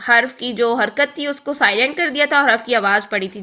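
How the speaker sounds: normal talking voice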